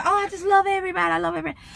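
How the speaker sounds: mock overflowing love, high and rushed; mock gushing love. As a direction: gush it out like you love the whole world, a little hammed-up. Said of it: "You know how you get those feelings when you go".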